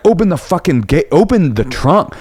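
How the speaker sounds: angry demand. Retold or imitated. Shouting demand at driver.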